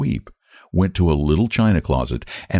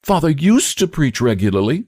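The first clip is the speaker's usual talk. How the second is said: eager, much higher; 10 semitones higher than usual. A narrator's reading. Joan eagerly tells Sarah about her father, higher. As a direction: tell it eagerly, like a keen child. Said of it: "said the girl eagerly".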